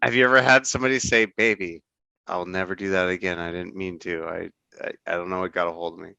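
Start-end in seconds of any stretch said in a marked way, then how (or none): 1.2-6.1 very soft, low, halting pleading; mock contrite apology. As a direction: play the sheepish apologizer, voice sunk low. Acting an abuser apologizing to partner.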